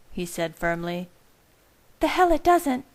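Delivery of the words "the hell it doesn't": higher sharp retort; Rosalie snapping. A narrator's reading. Rosalie rejects Carlisle's claim that this doesn't involve her, snapping. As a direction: snap it back at him as Rosalie. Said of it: "snapped Rosalie".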